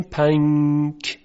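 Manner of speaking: پنک drawn out slowly, with a nasal نون before ک; slow hidden n. Shown as a demonstration.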